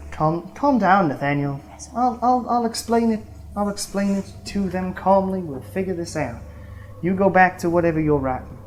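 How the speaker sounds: calm him down and take over explaining; Zoe. Acted. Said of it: "And Zoe's like".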